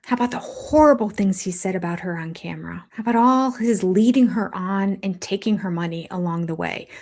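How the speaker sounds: hushed unvoiced whisper. Real, not acted. Host listing Cody's wrongs, whispering. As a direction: whisper each accusation. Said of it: "whispered list of grievances".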